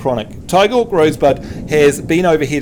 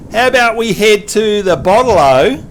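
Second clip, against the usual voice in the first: Australian accent demo; 3 semitones higher, 4 dB louder than usual. Shown as a demonstration. Host models a phrase for the learner, Australian accent. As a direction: draw it out in broad Australian. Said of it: "show you how to talk Australian".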